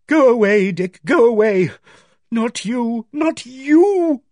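high, loud anguished plea; breaking down. A narrator's reading. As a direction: send him away in rising desperation. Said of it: "She broke then".

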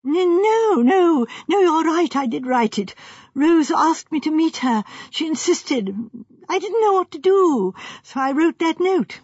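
high, stammering confession; flustered young architect. Acted. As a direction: back down in agitation. Architect breaks down and admits it.

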